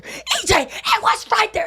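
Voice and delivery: high-pitched imitation of a furious yell; parents screaming. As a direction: act out a panicked parent yelling. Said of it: "parents just screaming at each other".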